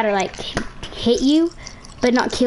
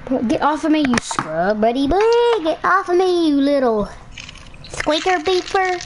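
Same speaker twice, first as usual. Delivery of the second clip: playful taunt; 6 semitones higher than usual. Real, not acted. Taunting opponent in game.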